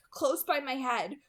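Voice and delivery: ordinary delivery, nothing marked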